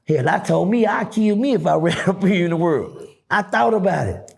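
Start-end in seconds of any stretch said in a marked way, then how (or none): none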